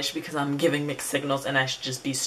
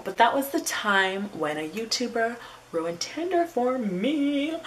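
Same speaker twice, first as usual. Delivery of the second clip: high, drawn-out title line; theatrical sign-off; 6 semitones higher, slower than usual. Real, not acted. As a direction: stretch it out in a raised, dramatic voice. Theatrical restating of the video title.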